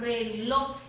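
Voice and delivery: Reloj in two drawn syllables, weight on the last; stress on final syllable. Shown as a demonstration. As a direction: lean on the LOJ of Reloj. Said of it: "dónde está la mayor fuerza de voz".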